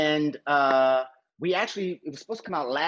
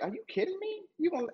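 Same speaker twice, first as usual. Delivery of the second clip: pitched way up, soft and incredulous; mock disbelief; 7 dB quieter than usual. Telling the host he couldn't believe Spielberg asked, mock disbelief. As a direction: squeak out the disbelief, half-laughing. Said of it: "And I'm like".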